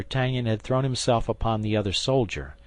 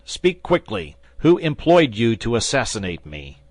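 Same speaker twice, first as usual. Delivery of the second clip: louder, commanding interrogation; stern demand; 3 semitones higher, 6 dB louder than usual. Acted. Swordsman interrogates the assassin, loud stern demand. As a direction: press him hard for the name.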